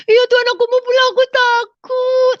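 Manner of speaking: put-on very high, loud childlike wail, last words dragged out; anak kecil ketakutan. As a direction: act a frightened small child begging God. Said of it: "kayak anak kecil di hadapan Tuhan". (rengekan anak kecil yang takut)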